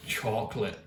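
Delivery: low, soft American chocolate; quiet mock-American pronunciation; American accent. Shown as a demonstration. Vlogger imitating how Americans say chocolate, American accent. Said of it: "Americans also say it".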